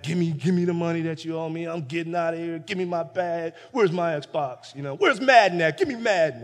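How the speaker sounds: pushy, rising demands; entitled younger son demanding his share; bratty demanding son. Retold or imitated. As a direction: grab for everything like a spoiled kid. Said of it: "talking crazy to his dad".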